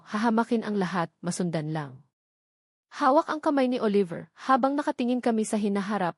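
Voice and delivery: usual speaking voice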